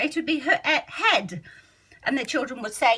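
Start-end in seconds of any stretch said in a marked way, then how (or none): 0.4-1.4 he'd read as head, with the short e sound; mis-decode he'd as head on purpose. Shown as a demonstration. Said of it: "follow the sounds logically".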